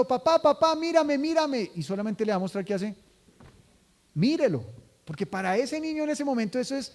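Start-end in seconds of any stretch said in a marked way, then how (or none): 0.0-1.7 put-on high, eager child's calling; excited child. Child calling his dad to watch him, high and eager. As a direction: call out like a child wanting attention. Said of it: "Cuando el niño está diciendo".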